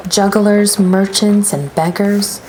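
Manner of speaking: ordinary delivery, nothing marked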